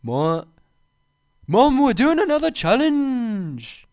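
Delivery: high, loud, stretched-out British YouTuber impression; high-pitched hammed-up hype shout; Morgs. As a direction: yell the announcement up high, drawing it out. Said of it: "is it a little higher".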